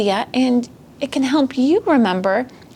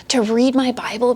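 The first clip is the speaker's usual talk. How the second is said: ordinary delivery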